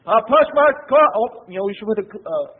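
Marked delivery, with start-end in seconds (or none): 0.1-1.1 smug churchgoer. Retold or imitated. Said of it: "come to church on Sunday and say".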